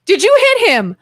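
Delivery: high-pitched retold teacher demanding an answer; mad teacher. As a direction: imitate a teacher confronting a first grader. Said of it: "she looks mad".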